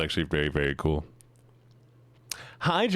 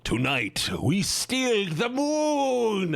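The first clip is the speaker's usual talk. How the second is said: high, slow, grand villain proclamation; slow dramatic evil-plan reveal; villain declaration; 10 semitones higher, much slower than usual. Acted. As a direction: announce the heist like a cartoon villain.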